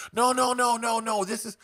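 high, hurried mock panic. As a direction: imitate her backpedalling in alarm. Seller panics, backpedals.

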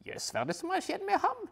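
puzzled gasp; detective asking his wife about the skier; surprised detective. Acted. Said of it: "spør Puriot".

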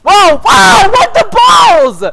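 high, loud yelp of disbelief; shocked outburst. Real, not acted. Player suddenly hit in game, shocked shout. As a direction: cry out in shock, stretching the last words.

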